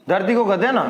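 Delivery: ordinary talk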